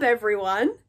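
usual speaking voice

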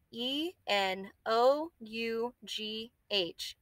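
slow, letter by letter; spelled out letter by letter. Shown as a demonstration. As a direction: spell each letter out slowly. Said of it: "spelling the word aloud".

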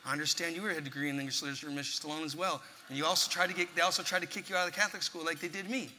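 quiet, fast rattled-off pitch; rushed nervous pitch. Retold or imitated. Young fighter pitching himself to Stallone, quiet and fast. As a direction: rush the whole résumé out softly before he walks away.

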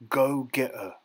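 menacing final order, pressed hard; dangerous. A narrator's reading. Bully orders smaller boy into the water, dangerous. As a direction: make the order a threat. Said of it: "soft and dangerous".

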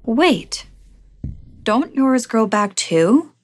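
slow, rising, puzzled question; curious. Acted. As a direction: ask it with honest curiosity. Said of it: "and added curiously".

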